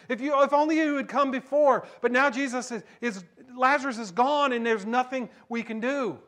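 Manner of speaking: high, loud fervent sermon voice; preaching at full force. Acted. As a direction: drive the story home to the congregation.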